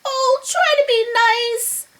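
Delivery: high-pitched timid plea; whimpering. Acted. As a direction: whine as the frightened lion. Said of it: "he whimpered".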